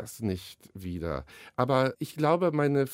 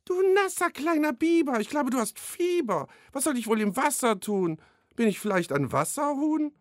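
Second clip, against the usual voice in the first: much higher, put-on little butterfly voice; fluttery butterfly; 12 semitones higher, 3 dB louder than usual. Butterfly mocks the wet beaver, high squeaky put-on voice. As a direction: squeak it out like a prissy tiny butterfly.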